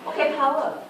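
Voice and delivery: imitating the lecturer. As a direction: rush the words out the way a fast lecturer does. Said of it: "she was saying".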